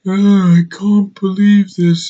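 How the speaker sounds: high, slow, breathy-sounding mock whimper of an actor; mocking Eddie Redmayne. Reviewer mocking Eddie Redmayne's line delivery, slow and high. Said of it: "he's talking like this".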